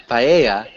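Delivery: paella as pie-EH-ya, Spanish style; Spanish pronunciation. Shown as a demonstration. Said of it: "We wouldn't change the pronunciation".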